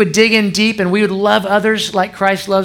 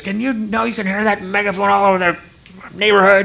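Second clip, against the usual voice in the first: higher-pitched, irate rant imitation; mad neighbor; 4 semitones higher than usual. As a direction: act out a fired-up neighbor ranting about noise. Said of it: "he was so mad at me".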